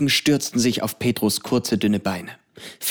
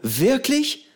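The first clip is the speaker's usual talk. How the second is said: high wide-eyed Wirklich; astonished cry; 13 semitones higher than usual. A narrator's reading. Pedro amazed that Johnny is a pirate, high. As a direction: gasp it out in amazement. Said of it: "rief Pedro und riss die Augen weit auf".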